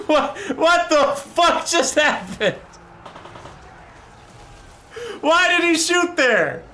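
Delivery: high, slow, loud wail of bewilderment; hysterical disbelief. Real, not acted. Streamer asking why the tank shot there, hysterical disbelief. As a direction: cry out, utterly baffled and cracking up. Stunned by game bug.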